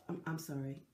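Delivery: ordinary talk, nothing marked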